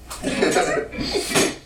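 giggly unvoiced laugh; a little breathy giggle; high giggle. Delighted at the decoration.

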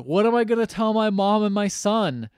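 loud, high-pitched acted indignation; mock outrage. Troy rebuffed over his barbecue invitation, mock outrage. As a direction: act out a hammed-up wounded tirade.

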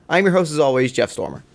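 ordinary delivery

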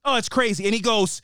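ordinary talk

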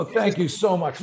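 overdone gratitude before the strike; grateful vagrant. Acted. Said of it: "role play as grateful homeless guy".

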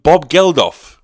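exasperated shout. Retold or imitated. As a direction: bark the name at the clueless man. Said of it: "I'm like".

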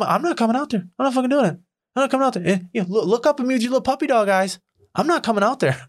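high, stubborn singsong refusal; defiant taunting. Retold or imitated. At the window, taunting the neighbor, high and defiant. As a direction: taunt her from above.

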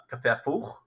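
usual speaking voice

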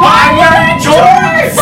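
high, slow, laughing yell; laughing shout. Real, not acted. Mocking the outfit, shouting.

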